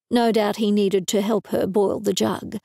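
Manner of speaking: plain reading voice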